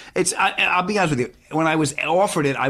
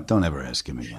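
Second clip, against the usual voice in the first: low, soft grumble behind his back; muttered aside; 12 semitones lower, 6 dB quieter than usual. Pretend. Crew at Video Village griping about actor, low mutter. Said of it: "they're going back to Video Village going".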